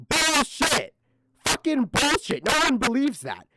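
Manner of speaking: high, loud, furious yelling; loud outraged yell; furious shout. Real, not acted. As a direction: shout him down in disbelief. Furious rejection of crying excuse.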